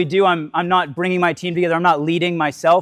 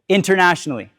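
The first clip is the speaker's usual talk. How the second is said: ordinary talk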